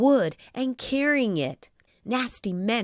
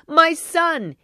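weeping with joy; 8 semitones higher, 6 dB louder than usual. A narrator's reading. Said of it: "Geppetto wept with joy".

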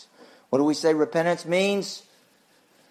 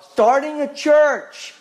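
mock incredulous; 10 semitones higher, 6 dB louder, slower than usual.

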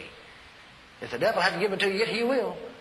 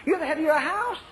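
high insistent God voice; 10 semitones higher than usual. Retold or imitated. God's voice retold.

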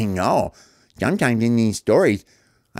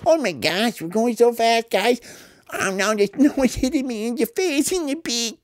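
normal talking voice

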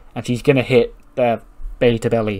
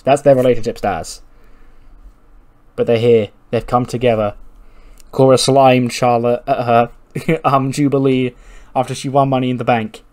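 hype announcer; 3 dB louder than usual. Acted. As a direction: sell the match like a promo trailer.

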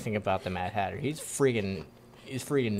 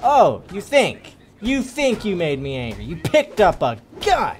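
indignant shouting; 7 semitones higher, 10 dB louder than usual. Real, not acted. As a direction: yell back at the game enemy, punching the key words. Retorting to game enemy about anger.

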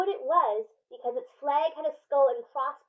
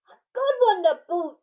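loud, higher hearty chorus; crew agreeing; 6 semitones higher, 6 dB louder than usual. Acted. Crew agrees loudly with their captain. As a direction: cheer it back as the crew. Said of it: "the others agreed".

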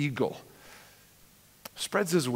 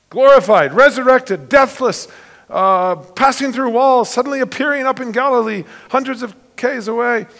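louder, higher rising preacher's proclamation; fervent preaching; 9 semitones higher, 8 dB louder than usual. Acted. Preacher exulting to the congregation about Jesus' glorified body. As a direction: build the wonder of the risen body.

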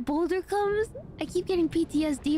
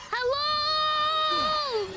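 shouting; 11 semitones higher than usual. Real, not acted. Shouting in game.

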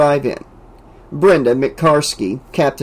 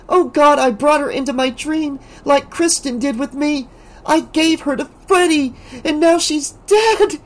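high, stricken, horrified words; horrified hiss; 13 semitones higher than usual. A narrator's reading. Alice to Rick in horror. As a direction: realize you killed your friend. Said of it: "she hissed".

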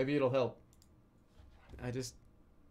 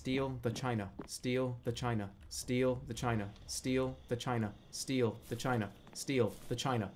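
quiet; 5 semitones lower, 6 dB quieter than usual. Shown as a demonstration. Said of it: "If I say it enough times".

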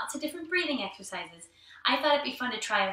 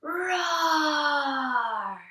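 bear roar; 5 semitones higher than usual. Pretend. Teacher being a bear with friends, playful roar. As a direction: stretch it out like a pretend bear.

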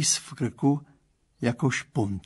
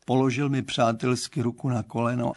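usual speaking voice

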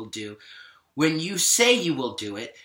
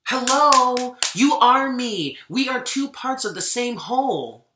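higher and louder, heated challenge; animosity; 8 semitones higher, 4 dB louder than usual. Real, not acted. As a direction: call them out with open resentment. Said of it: "there's some animosity in my voice right now".

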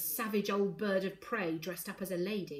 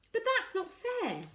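put-on high, slow girlish protest; child protesting; slower than usual. A narrator's reading. Girl objects to unfair schooling, protesting. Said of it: "Morrigan protested".

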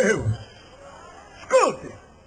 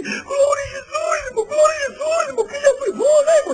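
mock tearful bawl, pitched way up; crying man; 13 semitones higher than usual. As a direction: imitate a man sobbing with gratitude. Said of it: "E ele chorando".

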